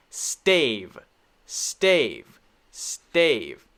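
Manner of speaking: "stave" with long A, like "stay" plus V; "stave" drawn out on the A. Shown as a demonstration. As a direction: drill "stave" separately, falling. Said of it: "is the long A sound".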